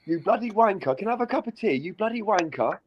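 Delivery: hammed-up British insult, pitched well up; mock British. American chat about British slang, hammed-up British accent.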